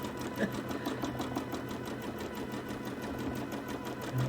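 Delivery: soft airy chuckle at herself; amused little laugh, barely voiced; quiet breathy laugh. Real, not acted. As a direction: laugh it off under your breath. Laughing at sounding posh.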